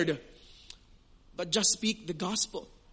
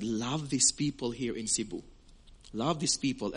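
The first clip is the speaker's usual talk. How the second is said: lower, quieter voice of God; God's voice, lower; 9 semitones lower, 6 dB quieter than usual. Retold or imitated. God rebuking the preacher, low and soft. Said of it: "He said".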